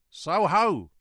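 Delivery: loud, high drawn-out call; roaring hail. A narrator's reading. Guard hailing a rider, loud roaring call. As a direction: sing it out as a roar. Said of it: "sang out as loud as he could roar".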